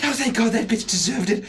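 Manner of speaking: high-voiced mother's fierce relief; vindictive mother. Mother hearing the enemy is dead, fierce relief.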